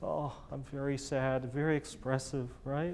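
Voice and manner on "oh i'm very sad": slow, softer, acted sorrow; a drawn-out little lament; mock sad. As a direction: play at being very sad. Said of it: "little sad".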